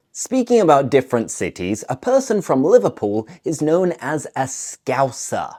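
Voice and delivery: usual speaking voice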